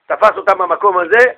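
ordinary delivery

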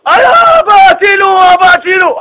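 high, drawn-out, loud cry for help; hammed-up desperate child's shout; screaming for help. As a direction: scream for Dad to rescue you. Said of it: "פתאום שועקים צעקות".

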